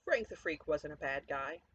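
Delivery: plain reading voice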